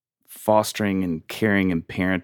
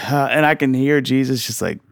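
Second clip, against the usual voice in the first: ordinary talk, nothing marked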